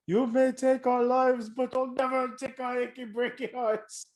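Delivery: bad attempt at a Scottish accent. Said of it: "Was that your Scottish accent?".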